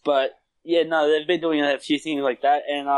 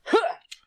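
short high laugh. Real, not acted.

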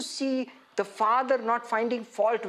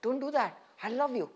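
very soft, hushed comforting voice; tender reassurance; 2 semitones lower, 7 dB quieter than usual. Acted. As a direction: gently plead with a dear friend not to despair, softly. Voices suffering Jesus comforting Peter.

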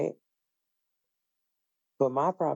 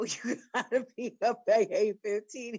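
higher, laughing through the words; laughing instruction; 7 semitones higher than usual. Retold or imitated. Aunt laughing as she tells nephew eight fifteen. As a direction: tell him the start time while cracking up.

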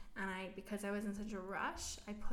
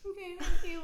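high, loud mock-tearful thanks; trying to be strong; 9 semitones higher, 10 dB louder than usual. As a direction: imitate holding back tears as you thank her. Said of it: "trying to be strong".

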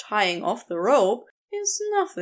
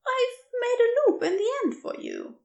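higher, lighter voice for the kind stranger; gentle rescuer voice; 13 semitones higher than usual. Acted. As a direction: offer your name brightly, a little quicker. Narrator voices Aziraphale.

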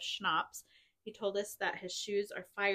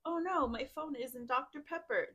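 mock-dismayed exclamation, pitched up; exclaimed dismay; 6 semitones higher than usual. Friend reads out a spilled-soda mishap, mock dismay. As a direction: read out a silly cry of dismay. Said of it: "he looked around and exclaimed".

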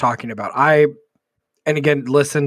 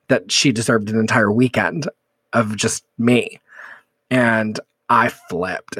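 ordinary talk, nothing marked

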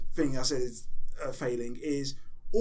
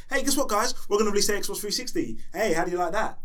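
higher, hyped-up company pitch; pitched up, punchy mock sales reveal; mock eager announcement; 4 semitones higher than usual. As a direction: imitate a company proudly springing big news. Mocking Nintendo's eager product announcement.

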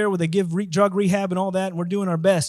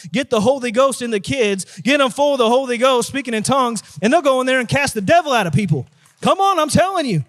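forceful preacher voice climbing to a high peak; rally the church, building phrase by phrase; rousing preaching; 4 semitones higher, 4 dB louder than usual. Real, not acted. Preacher urging church to reach the kids, rousing preaching.

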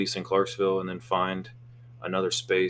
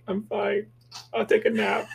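put-on high, squeaky girl voice; internet girl impression; 13 semitones higher than usual. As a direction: mimic a whiny internet girl. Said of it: "that girl on the internet".

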